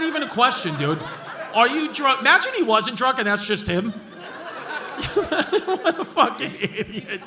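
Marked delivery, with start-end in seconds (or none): 4.0-7.3 laughing. Real, not acted. Laughing at drunk heckler.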